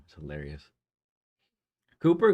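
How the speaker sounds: ordinary delivery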